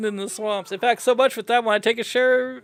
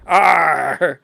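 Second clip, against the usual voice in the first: a loud, low startled yelp; startled cry; 3 semitones lower, 5 dB louder than usual. Acted. Father startled by the bear cub, loud yelp.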